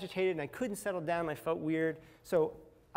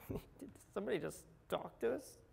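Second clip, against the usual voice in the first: high, soft incredulous question; hushed disbelief; 5 semitones higher, 7 dB quieter than usual. Retold or imitated. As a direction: mimic stunned bystanders under your breath. Acts out stunned bystanders.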